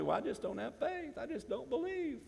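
mocking a faithless churchgoer, whiny and high; mock doubter.

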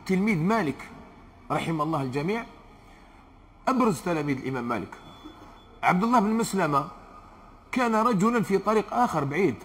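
normal talking voice

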